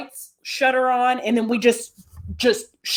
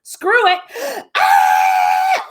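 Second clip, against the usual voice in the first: high mock cry into a gasp and scream; reenacted panic scream; 8 semitones higher, 4 dB louder, much slower than usual. Wrestler retelling her panic on the plane, reenacted scream. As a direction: act out losing it, then scream. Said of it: "I was the one that started the screaming".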